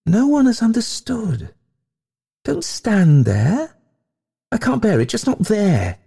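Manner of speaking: very high, overwrought lament; distraught ghost lady. A narrator's reading. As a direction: plead high, as if unable to bear it.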